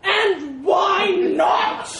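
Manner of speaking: very slow, high, booming elephant bellow; blasting shout. A narrator's reading. Elephant challenging the mouse over the bridge, blasted. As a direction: blast each word out hugely. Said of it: "the elephant blasted".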